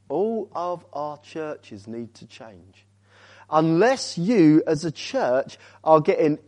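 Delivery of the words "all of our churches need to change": slow and soft, each word spaced; slow, weighty repeat. Real, not acted. Repeats point slowly for weight.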